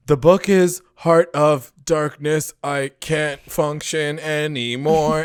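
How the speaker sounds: slow, heavily spaced words; slow robot-like delivery, pitch slightly up; robotic teacher. Retold or imitated. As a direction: imitate a possessed teacher, word by word. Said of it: "it's very robot".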